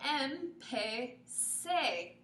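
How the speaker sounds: em-pe-se broken into very slow syllables. Shown as a demonstration. Teacher sounds out empecé slowly for students. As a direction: stretch em-pe-se out syllable by syllable. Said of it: "keep that sar sound".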